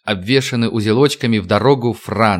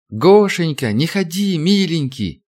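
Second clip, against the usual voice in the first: pleading; 6 semitones higher, slower than usual. Acted. Wife begging husband not to go.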